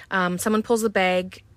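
usual speaking voice

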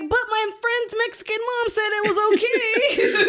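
high-pitched pleading kid, retold; hammed-up begging to mom; pleading child. Speaker as a child begging mom for a quinceañera, mock pleading. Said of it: "And I was like".

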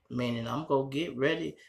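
ordinary delivery

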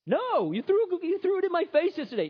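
high, indignant refusal; indignant bartender. Acted. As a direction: refuse him, still sore about yesterday.

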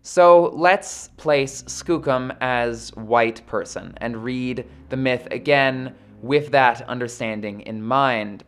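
normal talking voice

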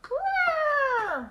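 very high elephant trumpet; high, drawn-out elephant call. Acted. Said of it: "an elephant trumpeting".

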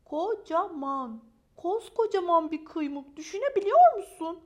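milk the drama, building to an incredulous question; dramatic exaggeration. Maya dwells on how huge it is, building dramatic exaggeration.

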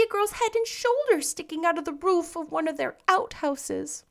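brightly voiced young heroine fretting; Anne's lively girlish voice. A narrator's reading.

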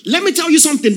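high, loud preaching; fervent preaching. Acted. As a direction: build the fire of a revival preacher. Preaching at full force.

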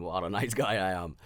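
laughing self-mocking boast; laughing at himself. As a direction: crack up as you brag. Joking about himself, laughing.

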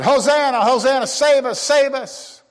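high ringing Hosanna, save us; crowd's cry. Retold or imitated. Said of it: "They said".

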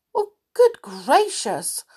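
high, loud alarmed cry. Acted. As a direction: gasp it out in alarm. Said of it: "said Franny in alarm".

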